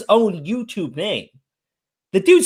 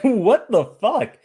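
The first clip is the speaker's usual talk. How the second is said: drawn-out disbelief; much slower than usual. Retold or imitated. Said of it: "I'm like".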